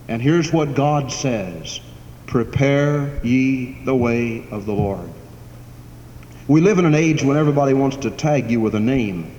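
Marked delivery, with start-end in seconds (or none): 2.3-5.1 slow, solemn intoning of the call; intoned scripture. Acted. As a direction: chant the prophet's words slowly. God's call quoted, intoned.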